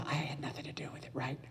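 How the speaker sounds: quick, hushed aside; whispered disclaimer. Acted. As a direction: mutter it fast under your breath. Repeats the disclaimer under the breath.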